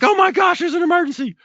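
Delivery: a hammed-up hysterical 911 dispatcher; panicky operator. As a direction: play the operator freaking out on the line. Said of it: "Are they freaking out?".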